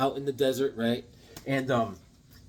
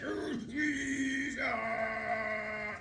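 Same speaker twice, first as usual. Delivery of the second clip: high, held cartoon effort noises; straining groans; 9 semitones higher, 10 dB quieter, much slower than usual. Retelling the hero lifting a crane, mock straining groans. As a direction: imitate heaving a huge weight.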